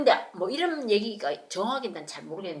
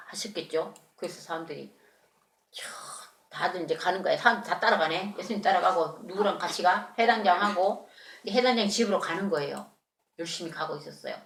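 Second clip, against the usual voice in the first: ordinary delivery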